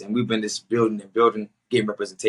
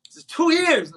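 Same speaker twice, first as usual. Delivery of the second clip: exasperated; 4 dB louder than usual. Real, not acted. Exasperated aside.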